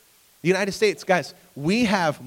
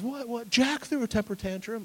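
put-on high, indignant little girl voice; outraged daughter; 8 semitones higher, 4 dB quieter than usual. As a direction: squeak out the injustice like an eight-year-old. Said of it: "And she goes".

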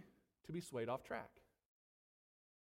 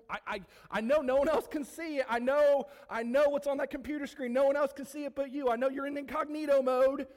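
urgent raised warning; 6 semitones higher, 7 dB louder than usual. Acted. Preacher warns congregation directly.

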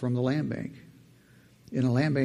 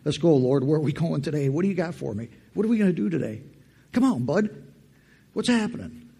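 playful eagerness; 3 semitones higher than usual. Retold or imitated. Cheerful morning talk with God.

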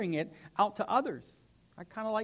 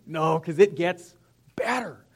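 tone change; 8 dB louder, slower than usual. Acted. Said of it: "did you hear the tone change".

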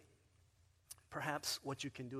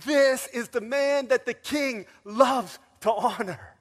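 much higher and louder, slow and ringing; proclamation; 9 semitones higher, 14 dB louder, slower than usual. Retold or imitated. Haman parading Mordecai, loud slow proclamation. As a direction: announce it to the crowd.

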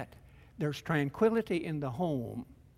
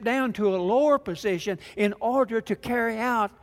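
raised, higher, louder preacher's voice building fervor; preaching at full force; 6 semitones higher, 5 dB louder than usual. Acted. Pastor driving home the sermon to his congregation, fervent loud preaching.

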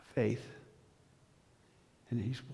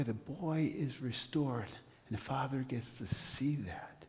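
hushed, fading to a whisper; 2 semitones lower, 8 dB quieter than usual. Real, not acted. Preacher telling the congregation of the healing, hushed to a whisper. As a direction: let the healing land in a hush. Tender climax of the story.

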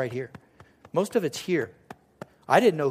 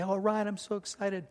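higher, lightly voiced excited grandmother; imagined excited grandmother; 5 semitones higher, 4 dB quieter than usual. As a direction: gush mildly as an imagined grandmother. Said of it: "I thought she was gonna say".

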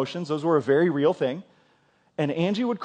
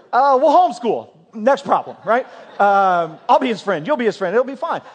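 breezy reassurance; 6 semitones higher, 6 dB louder than usual. Retold or imitated. Cheerful dismissal of wife's worry.

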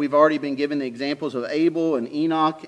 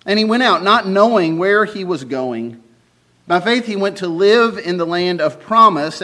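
loud, solemn Bible reading; scripture reading; 2 semitones higher, 5 dB louder than usual. Acted. Reads Hebrews passage aloud.

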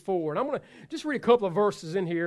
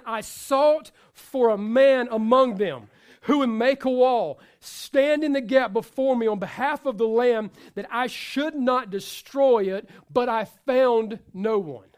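louder, slow, weighty reading; scripture read with force; 3 semitones higher, 7 dB louder than usual. Acted. Preacher reading Ezekiel to congregation, slow and loud. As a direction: proclaim the verse slowly.

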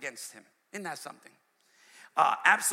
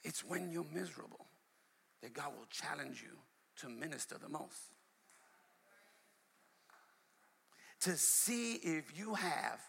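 much softer, low, intimate pulpit voice; hushed preaching; 3 semitones lower, 12 dB quieter than usual. Acted. Preacher confiding to the church in a hushed voice. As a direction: pull them in close, almost confiding.

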